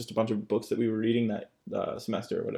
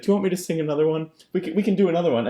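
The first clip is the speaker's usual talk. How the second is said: fast, higher eager voice; eager offer; 7 semitones higher, 5 dB louder, much faster than usual. Retold or imitated. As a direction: rush the offer like a keen teacher. Teacher voiced in retold story.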